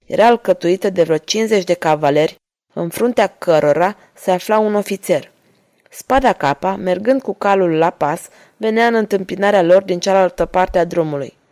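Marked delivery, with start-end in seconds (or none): none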